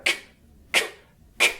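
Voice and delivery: k spat out hard and sharp; short spitting k, k, k; spat k sounds. Shown as a demonstration. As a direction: spit each k at her. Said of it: "practically spitting at her".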